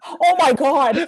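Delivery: drawn-out loud oh my God; mock exasperation. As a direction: groan it out, slow and loud. Mock dismay at own pronunciation.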